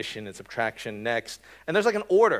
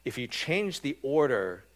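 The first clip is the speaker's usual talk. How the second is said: normal talking voice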